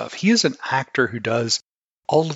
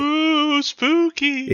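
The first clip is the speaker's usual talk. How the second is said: high teasing ghostly ooh; mock spooky; 3 dB louder, much slower than usual. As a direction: tease it like a campfire ghost. Mocking the spooky intro.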